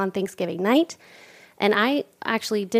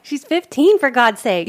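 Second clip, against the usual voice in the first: incredulous; 4 semitones higher, 7 dB louder than usual. Real, not acted. Exclaiming in disbelief.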